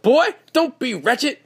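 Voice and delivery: high, loud, drawn-out mom scolding; ad's scolding mom. Retold or imitated. Host mimicking the mom in a lawyer ad, scolding mom. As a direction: imitate a mother telling off her boy. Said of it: "with the mom".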